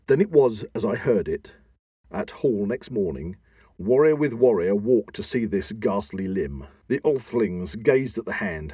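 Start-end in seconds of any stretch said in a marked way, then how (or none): none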